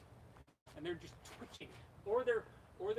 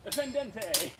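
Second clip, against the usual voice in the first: louder mock fencing-master call; announcing an attack; 3 semitones higher, 5 dB louder, slower than usual. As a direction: declare the technique like an anime fighter. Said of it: "just announcing the thing he's killing you with".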